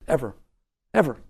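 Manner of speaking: ordinary talk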